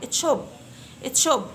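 تشوب with t and sh joined into a crisp ch; ch sound. Shown as a demonstration. Teacher showing the ch in chop to fifth graders. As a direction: snap out the ch of تشوب. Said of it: "يعني ت وشين مع بعض".

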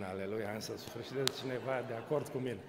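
ordinary delivery

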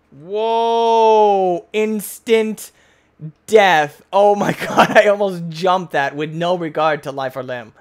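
loud, high cry of shock; startled alarm. Real, not acted. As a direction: blurt it out after a near miss. Nearly jumped to death.

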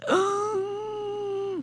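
high, strained wheeze for breath; drawn-out choked whine; choking wheeze. Retold or imitated. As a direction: imitate a long, airless choking gasp. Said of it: "I sound like this".

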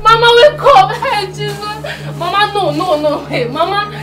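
high, loud sobbing cries of Mama; keening and begging her to wake up; wailing grief. Acted. Granddaughter wailing over her dead grandmother, crying for help.

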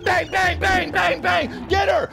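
very high-pitched, strained panicked shouting; frantic yelling. Acted. Attackers struggling to grab a man, frantic high yelling. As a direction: yell in a hammed-up high frenzy. Acting out a chaotic violent struggle.

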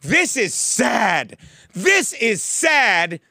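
drawn out and loud, each word weighted; slow loud declaration. Retold or imitated. Walking out of Starbucks, slow loud proclamation. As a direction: storm out declaring it loudly and slowly. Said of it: "I'm like".

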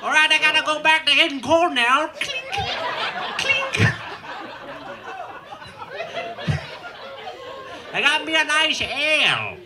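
high, bright-free old prospector put-on; hammed-up old miner voice. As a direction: play a grizzled prospector toasting. Mock prospector voice.

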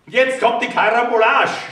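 high, loud shout bursting out. A narrator's reading. Tomaschek yells at the narrator in a loud, high shout. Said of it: "schrie mich der Tomaschek an".

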